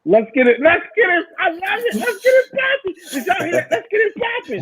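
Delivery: high squealed, laughing hype; hyped-up echo. Real, not acted. Gleefully echoing guest's catchphrase.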